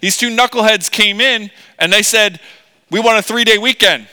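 high, loud mocking retelling; hammed-up exasperated boss relaying a request; Pharaoh. Pharaoh reports two knuckleheads' request, exasperated. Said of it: "he's gonna say".

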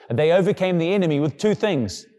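ordinary talk

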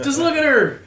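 high, loud gushing awe; excited. Retold or imitated. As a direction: rave about her, loud and high. Gushing over Daenerys.

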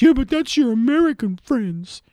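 high put-on mimicking voice; mocking his co-host. Host predicting what co-host will say to him, high mock voice. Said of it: "what you're now gonna say to me".

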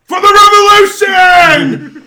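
high, very loud slow war cry; battle cry. Acted. GM's townsman rallying the crowd, loud battle cry. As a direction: bellow it to rally the mob.

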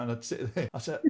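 ordinary talk

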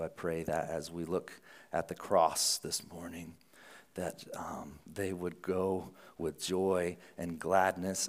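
soft, low, unhurried prayer voice; quiet prayer. Real, not acted. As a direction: pray quietly before the congregation. Leads an opening prayer.

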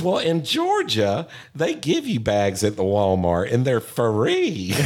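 slow, high, sugary Southern drawl imitating his wife; hammed-up Southern accent, sweet and slow; Southern bless your heart voice. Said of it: "the most Southern bless your heart voice".

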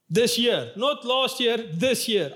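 this year hit firmly, not last year set against it; stress on this year. Shown as a demonstration. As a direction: punch this year both times.

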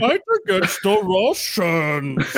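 slow, high rallying speech; hammed-up address to the nation; mock wartime leader. Fake leader addressing the people of Ukraine, slow and overblown.

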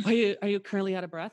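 rushed mimicry of a winded caller; out of breath. Winded caller checking on a quarantined patient, out of breath. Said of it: "you sound like you're out of breath".

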